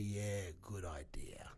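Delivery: slow, near-whispered reply; hushed agreement. Acted. Comic agreeing at his sliding door moment, hushed and slow. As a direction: say it softly, letting the moment land.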